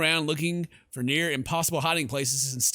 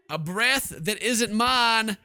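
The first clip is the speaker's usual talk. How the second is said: higher, louder, slow, rattled; spooked repeat; 6 semitones higher, 4 dB louder, much slower than usual. Real, not acted. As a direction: say it again genuinely creeped out.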